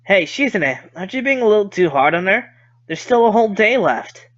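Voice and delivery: usual speaking voice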